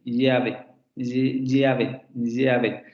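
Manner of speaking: llave repeated with the lateral palatal ll, not a plain y; slow demo: llave with the tongue spread on the palate. Shown as a demonstration. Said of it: "No llegaría a ser llave como este de aquí".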